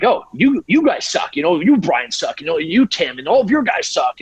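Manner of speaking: mock troll insult. Troll baiting the online groups, mocking jeer. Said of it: "And I'm like".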